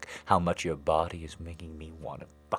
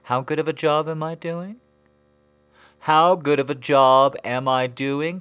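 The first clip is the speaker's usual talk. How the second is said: high, loud taunting question; 10 semitones higher, 17 dB louder than usual. Acted.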